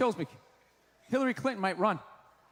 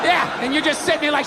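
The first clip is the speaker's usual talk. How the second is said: ordinary delivery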